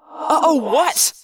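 quick, sharp snap; snapped question. A narrator's reading. Jake reacting to an uh-oh, snapped question. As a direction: snap it back, rising at the end. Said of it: "Jake snapped".

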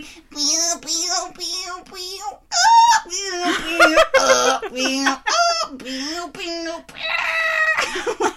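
very high piping pews, laughing; hammed-up shrill recorder squeaks; squeaky recorder noises. Mimicking a bad recorder.

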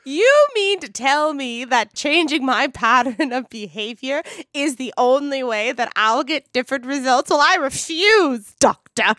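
hammed-up refusal to change; mock defiant. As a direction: dig in like a stubborn patient.

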